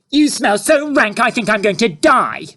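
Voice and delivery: put-on high, loud disgusted girl. A narrator's reading. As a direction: complain about the smell.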